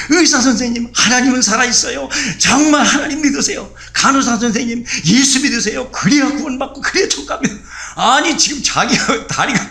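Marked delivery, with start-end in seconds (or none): 0.1-7.5 high, loud, eager evangelizing; fervent preaching. Retold or imitated. Injured man urging doctors and nurses to believe, fervent and loud. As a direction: call out to the hospital staff with joy.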